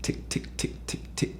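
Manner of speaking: soft whispered tick tick of claws. Retold or imitated. Telling the ghost story, whispering the claw ticks. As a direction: breathe out the ticks very softly. Imitating claws behind her.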